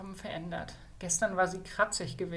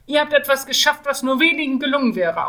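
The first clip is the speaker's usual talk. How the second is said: higher, much louder scolding; stern rebuke; 7 semitones higher, 13 dB louder than usual. Acted. Kjeld scolding the mage to be proud, loudly. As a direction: drive the point home hard.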